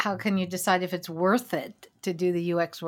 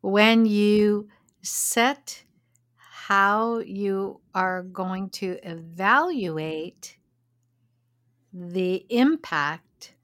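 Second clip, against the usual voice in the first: slow and deliberate; much slower than usual. Real, not acted. Slow careful definition.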